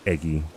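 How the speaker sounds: "Eggy" with a short e sound in place of the a of Aggie; mispronounced Aggie. Retold or imitated. Imitating how Bree says Aggie, "Eggy" with a short e. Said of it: "She says".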